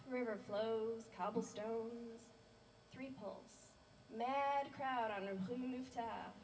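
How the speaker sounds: measured poem reading with weighted phrases and pauses; poetry recital. Acted. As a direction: recite it to a live audience, lingering on key words. Recites own poem to audience.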